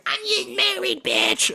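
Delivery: high, laughing scream; girls screaming. Retold or imitated. Party girls bump into us, high laughing shriek. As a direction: shriek it like a bachelorette. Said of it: "and scream".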